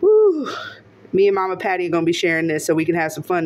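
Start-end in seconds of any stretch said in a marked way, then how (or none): 0.0-0.5 short, high, louder "woo"; real joyful hoot; excited whoop. Real, not acted. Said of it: "I'm so excited".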